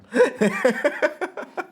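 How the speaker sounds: a real high-pitched burst of laughter; high giggle. Real, not acted. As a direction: laugh out loud at your own screw-up. Laughing at his own slip.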